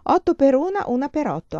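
a touch of laughter, lifted and bright in pitch; answering laughing. A narrator's reading. Emanuela answers her friend with the motto, lightly laughing. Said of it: "Quella rispose ridendo".

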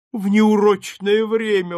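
a high, loud, slow outcry of delight; exclaimed. A narrator's reading. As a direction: repeat the words as a happy protest. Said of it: "воскликнул пылкий джентльмен". (пылкое восклицание, выше, громче и медленнее)

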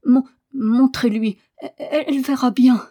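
high, stammered, tearful protest; between sobs. A narrator's reading. Boy consenting to the examination, high sobbing stammer. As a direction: break the words as if sobbing. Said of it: "articula entre deux sanglots".

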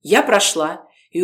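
ordinary delivery, nothing marked

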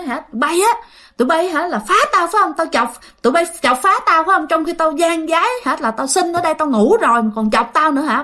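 high, indignant scolding; angry confrontation. Acted. Father confronts the ghosts for disturbing his sleep, angry scolding. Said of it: "cái lúc đó bà tức lắm".

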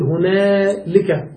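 "هنالك" drawn out very slowly, the added lam clear; slow teaching example "هنالك"; slow demo. Shown as a demonstration. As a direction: stretch "هنالك" so the lam stands out. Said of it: "فتقول".

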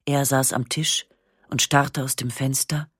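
plain reading voice

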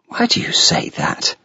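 startled whisper; a shocked, breathy question; in surprise. Acted. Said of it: "I said in surprise".

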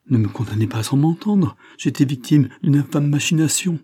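loud, high-pitched desperate plea; supplication véhémente. Acted. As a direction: plead your innocence with rising urgency. Il plaide son innocence, désespéré. (supplication véhémente, voix haute et forte)

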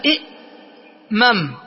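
إئمم stretched out very slowly; slow careful pronunciation. Shown as a demonstration. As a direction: sound out إئمم slowly to show the form. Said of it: "ننقل حركتها إلى الهمزة الساكنة". (نطق إئمم ببطء للتوضيح)

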